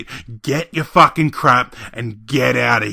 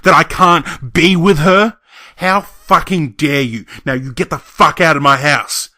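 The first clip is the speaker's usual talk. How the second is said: raised, emphatic indignant rant; angry outburst; 12 semitones lower, 7 dB louder than usual. Acted. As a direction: let pent-up grievances burst out. Acted furious rant at relatives.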